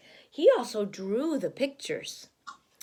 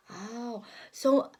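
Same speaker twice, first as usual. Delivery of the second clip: plain reading voice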